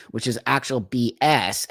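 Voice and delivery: ordinary talk, nothing marked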